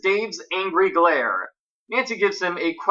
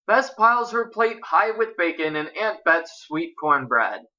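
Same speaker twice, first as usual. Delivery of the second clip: plain reading voice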